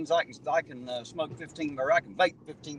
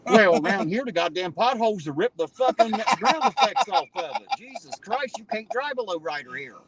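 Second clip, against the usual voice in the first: high-pitched, worked-up complaint; loud start, riding high throughout; exasperated rant; 9 semitones higher, 4 dB louder than usual. Real, not acted. Exasperated rant about local potholes.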